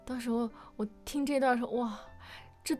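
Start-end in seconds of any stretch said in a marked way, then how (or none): none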